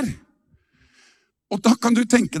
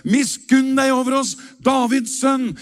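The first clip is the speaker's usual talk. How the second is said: raised, pitched-up plea; higher pleading cry; crying out for mercy; 7 semitones higher than usual. Retold or imitated. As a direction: call out to the passing Messiah. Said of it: "Så de ropte".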